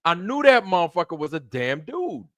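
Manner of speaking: high, loud, mock exasperated outburst; retold exasperation. To viewers about being fooled, high loud mock exasperation. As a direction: exaggerate the "I knew it" frustration. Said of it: "Every time you're like".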